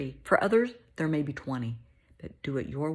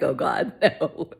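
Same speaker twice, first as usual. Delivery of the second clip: laughing mock dismay, louder; mock groan; 8 dB louder, slower than usual. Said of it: "it's gonna be like".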